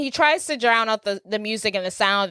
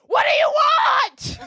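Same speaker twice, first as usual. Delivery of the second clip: high shouted Grinch voice; Grinch yelling; 6 dB louder, slower than usual. Retold or imitated. Grinch shouting at Cindy to get out. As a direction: yell it out as the cranky Grinch.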